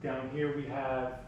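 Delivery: ordinary talk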